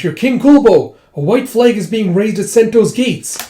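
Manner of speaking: high, loud acted call; alarmed announcement. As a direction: shout urgent news up to the king. Messenger shouts news to king.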